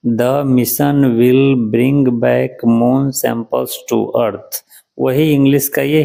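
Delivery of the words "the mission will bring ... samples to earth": very slow, each word spelled out for learners; teacher reading a headline aloud very slowly. Shown as a demonstration. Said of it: "Hindi उच्चारण क्या है".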